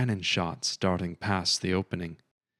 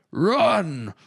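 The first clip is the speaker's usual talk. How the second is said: a loud, much higher roar; 9 semitones higher, 5 dB louder than usual. A narrator's reading. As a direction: roar it as a last command. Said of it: "roared Einar".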